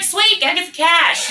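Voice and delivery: high-pitched, pleased acceptance; retold eager agreement. Agreeing to the copy machine job, eagerly. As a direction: jump at the easy money. Acts out own glad reply.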